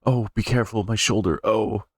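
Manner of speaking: high, pained outcry; high-pitched yelp of hurt; cry of pain. Acted. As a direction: wince through it, hurt shoulder. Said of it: "Linda cried out".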